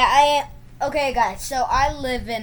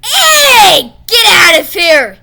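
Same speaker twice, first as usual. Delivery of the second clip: very loud, high yell; angry shout; 7 semitones higher, 15 dB louder, much slower than usual. Real, not acted. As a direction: yell at the attacker. Enemy kills friend in game.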